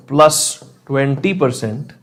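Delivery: normal talking voice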